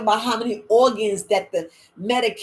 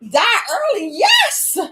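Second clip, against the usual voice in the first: much higher, louder exclaim; mock shout; 12 semitones higher, 6 dB louder, slower than usual. Audience shocked by the warning, a loud high exclaim. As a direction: yell it back in disbelief. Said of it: "You'd be like".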